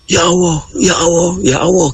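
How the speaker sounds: high, pleading Ya Allah; panicked cry. Retold or imitated. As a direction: cry out to God in terror. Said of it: "Terus dia bilang". (berseru ketakutan dengan suara tinggi)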